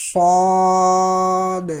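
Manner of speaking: صاد long and full-mouthed; full heavy saad. Shown as a demonstration. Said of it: "اس کو آپ نے پر پڑھنا ہے".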